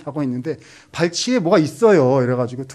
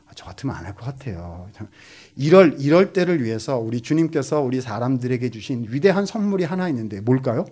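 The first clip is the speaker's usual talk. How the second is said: ordinary delivery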